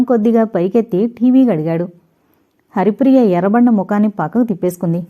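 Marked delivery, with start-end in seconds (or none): none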